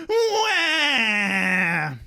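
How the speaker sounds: loud fake baby wail "Wah!"; mock crying wail. Podcaster mocks a crying son in the skit, fake wail. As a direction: bawl like a whiny kid, hammed-up.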